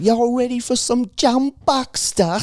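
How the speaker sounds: put-on high MC hype in British accent. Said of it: "I always just break out in British accents".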